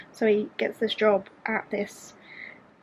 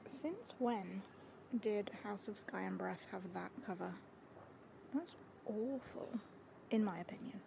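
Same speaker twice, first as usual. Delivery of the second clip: very soft, hushed grumble; hushed whisper; 19 dB quieter than usual. Real, not acted. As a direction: grumble under your breath. Hushed complaint about book cover.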